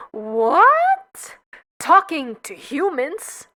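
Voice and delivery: high, loud shocked gasp of a question; scandalised relative, loud and high. Acted. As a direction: recoil in disbelief. Shocked family member.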